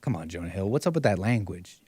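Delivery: ordinary talk, nothing marked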